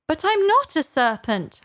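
high-pitched, emphatic denial; insistent protest. A narrator's reading. Alice denying it to the pigeon, insistent.

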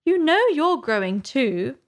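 high, bold Alice; bolder. A narrator's reading. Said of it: "said Alice more boldly".

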